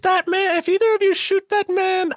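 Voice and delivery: a damsel's high-pitched ultimatum; falsetto ultimatum as Isabella. Pretend. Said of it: "pretend to be Isabella".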